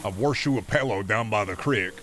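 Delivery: mild hammed-up rural Iowa accent; mock rural Midwest accent. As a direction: play a down-home Iowan country bumpkin. Imitating rural Iowan folk.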